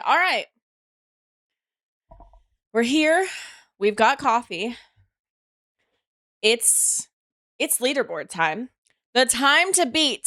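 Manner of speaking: loud, high, pumped-up hello to the stream; hyped greeting. Real, not acted. Streamer greets chat, loud and excited. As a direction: kick off the stream with energy.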